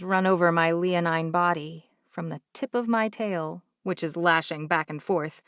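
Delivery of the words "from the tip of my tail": plain reading voice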